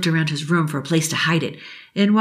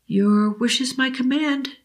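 higher, slow, solemn genie voice; kind old genie; 7 semitones higher than usual. Acted. Genie assuring Billy she is real, slow and higher. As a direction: promise it like a fairy godmother.

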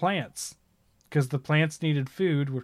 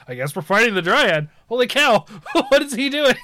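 astonished; 8 semitones higher, 6 dB louder than usual. Retold or imitated. Party reacts to berserk character.

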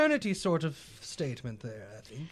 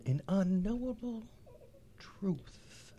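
soft, trailing to a breath of a whisper; hushed echo; 2 semitones lower, 9 dB quieter, much slower than usual. Acted. Player echoing a riddle to the party, hushed and fading. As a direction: let it fade away into a whisper. Echoes riddle line in a hush.

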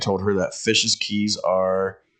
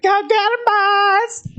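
very high, hammed-up drunk cheer; drunk heckler; 4 dB louder, slower than usual. Comic imitates drunk woman cheering on firefighters, shrieky mock cheer. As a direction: holler it high like a tipsy audience member. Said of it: "some drunk bitch is like".

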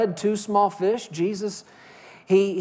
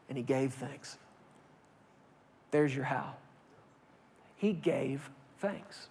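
reverent hush; 8 semitones lower, 13 dB quieter than usual. Real, not acted. Preacher hushing the church on the key truth. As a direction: drop to a near whisper.

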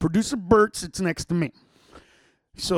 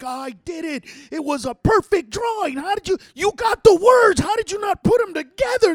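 high, shouted outraged rant; acted tantrum at a teammate; pissed off; 11 semitones higher, 6 dB louder than usual. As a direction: yell in indignant disbelief. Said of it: "you just get so pissed off".

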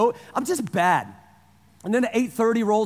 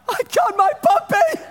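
high, loud squeal of delight; giddy puppy joy, much higher and louder; 12 semitones higher, 5 dB louder than usual. Retold or imitated. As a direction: squeal like a kid at Christmas. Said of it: "I'm forty and I'm giddy".

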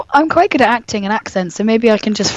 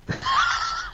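shrieking laugh; 11 dB quieter than usual. Real, not acted. Laughs at own joke.

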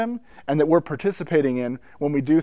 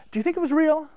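much higher, hesitant voicing of dad asking; dad's earnest question; 13 semitones higher, faster than usual. Father asking his son at lunch about the glowing cross, a hint of hushed wonder. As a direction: wonder aloud after a pause, like the speaker's father. Dad asks after church service.

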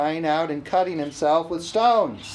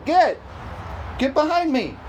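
higher-pitched acted command; stern rebuke; 6 semitones higher than usual. Jesus to the devil influencing his disciple, acted rebuke. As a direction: order the tempter back, voice raised.

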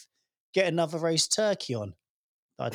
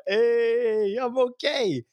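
higher, louder chirpy hey; cheery selfie voice; 6 semitones higher, 4 dB louder, much slower than usual. Retold or imitated. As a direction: mimic a bright thumbs-up patient. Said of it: "with a little thumbs up like".

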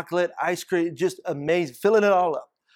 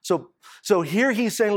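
ordinary delivery

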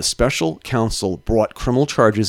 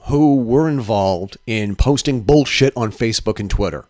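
usual speaking voice

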